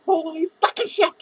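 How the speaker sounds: normal talking voice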